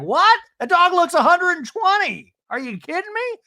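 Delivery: high, loud, shocked impression; mock outraged Tamara. Recapping Tamara's shock at an old dog, high and loud. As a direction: shriek it in disbelief at the dog. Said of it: "And Tamara's like".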